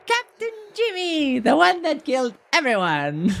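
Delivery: hammed-up banquet toast; mock toast. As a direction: raise a glass in fake ceremony.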